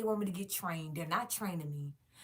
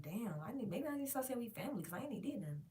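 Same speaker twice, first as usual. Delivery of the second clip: quiet to herself; 2 semitones higher, 11 dB quieter, faster than usual. Retold or imitated. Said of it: "I'm like".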